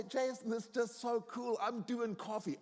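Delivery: high-pitched, excited re-enacted boast; delighted dad. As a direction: play a dad thrilled with himself. Dad's delighted boast to his son.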